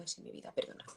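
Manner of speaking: near-whispered sorry, very soft; sheepish whisper to her son; meek apology. Retold or imitated. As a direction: shrink back and apologize under your breath. Mother apologizes softly to son.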